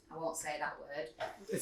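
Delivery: quiet slow aside to listeners; hushed aside. Real, not acted. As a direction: drop it to a murmur. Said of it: "aside withheld swear".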